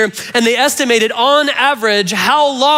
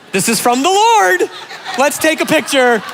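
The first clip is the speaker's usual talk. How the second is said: mock excited husband; 5 semitones higher than usual. Pastor playing a husband begging his wife for a car, laughing and higher. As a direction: gush like it's a gift from God. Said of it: "nudging your spouse going".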